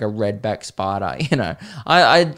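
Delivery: usual speaking voice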